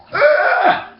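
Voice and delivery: sudden high squeal of being gagged; choked yelp. Retold or imitated. Comic caught off guard, a high gagging yelp. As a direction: yelp as something is stuffed in your mouth.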